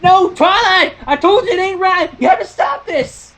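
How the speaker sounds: high, pleading shout; shouting friend. A narrator's reading. Applejack shouts at Twilight to stop, high and pleading. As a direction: yell at her to stop. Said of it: "friends shouting".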